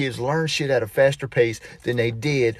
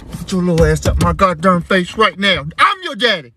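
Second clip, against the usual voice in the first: raised, forceful comic scolding; offended dad; 4 semitones higher, 5 dB louder than usual. Acted. Insulted dad telling daughter off, indignant. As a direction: pitch climbs in mock outrage.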